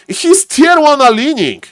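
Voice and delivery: high-pitched commentator exclamation; excited shoutcast pitched sharply up; sudden excited call. Real, not acted.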